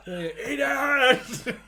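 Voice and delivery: loud high laughing shout; mock shout. Shoving cake in a friend's face, laughing mock shout. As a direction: shove it at him with a yell.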